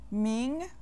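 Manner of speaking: Ming rising steadily; second tone. Shown as a demonstration. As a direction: glide the pitch upward through Ming. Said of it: "with the second tone".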